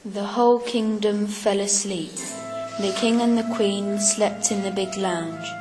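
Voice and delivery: plain reading voice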